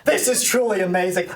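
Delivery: normal talking voice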